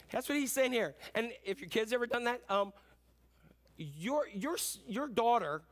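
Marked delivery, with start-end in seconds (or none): none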